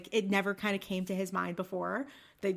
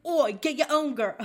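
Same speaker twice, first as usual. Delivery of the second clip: higher, louder British "Oi" voice; British accent; 7 semitones higher, 7 dB louder than usual. Retold or imitated. Mocking the boyfriend shooing Spud, British accent. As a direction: put on a cockney bark. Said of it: "He's like".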